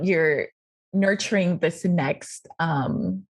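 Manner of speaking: normal talking voice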